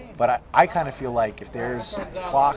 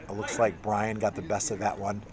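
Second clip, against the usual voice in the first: usual speaking voice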